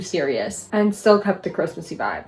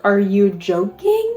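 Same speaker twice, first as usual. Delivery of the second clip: stretched-out, dragged disbelief; drawn out, can't believe it; slow disbelief; slower than usual. Real, not acted. Shows the cover in disbelief.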